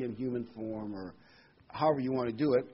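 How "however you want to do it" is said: usual speaking voice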